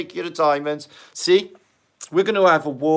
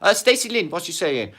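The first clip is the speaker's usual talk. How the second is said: usual speaking voice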